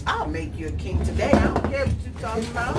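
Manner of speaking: loud, high, delighted hello; welcoming a friend to the bookstore, loud and delighted; excited greeting. Real, not acted. Teasing the friend who refuses.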